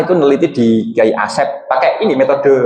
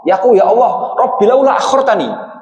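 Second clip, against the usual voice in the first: raised pitch, pleading like a dying man calling out; mock yell; 7 semitones higher than usual. Dying man begging God for more time, mock yell. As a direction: cry out to God for more time, half-acted. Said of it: "Bengok dia".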